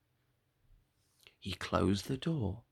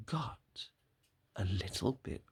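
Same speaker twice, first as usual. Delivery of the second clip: 1 whispered; 8 dB quieter than usual. A narrator's reading. Suspense narration.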